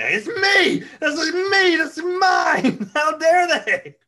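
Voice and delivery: hammed-up tantrum yelled through laughs; screaming rant. Co-host imagining the preacher screaming alone in a room, mock tantrum. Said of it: "just in a room screaming".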